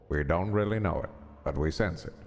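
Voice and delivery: low, measured epigraph reading; solemn reading aloud. Acted. Streamer reading a game's opening text, solemn low reading. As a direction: read it low and grave, with pauses.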